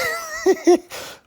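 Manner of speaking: high wheezy giggle; giggling. Real, not acted. Vlogger starting to explain the funny thing, high giggle. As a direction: giggle helplessly at the thought. Amused by the impression.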